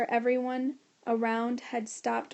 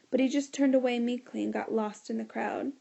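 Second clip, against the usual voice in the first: plain reading voice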